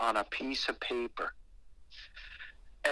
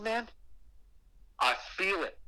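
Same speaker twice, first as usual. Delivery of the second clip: normal talking voice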